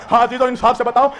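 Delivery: normal talking voice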